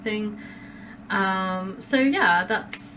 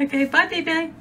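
laughing goodbye; 7 semitones higher than usual. Real, not acted. Laughing sign-off.

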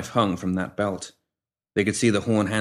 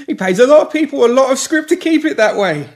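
desperate; 9 dB louder than usual. A narrator's reading. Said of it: "a tinge of desperation".

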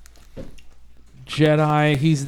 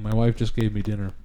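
mouth full; 7 semitones lower, 3 dB quieter, faster than usual. Real, not acted. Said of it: "I'm sorry that I'm eating".

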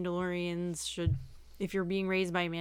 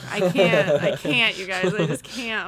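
exasperated; 8 dB louder than usual. Real, not acted. Overwhelmed by the naming.

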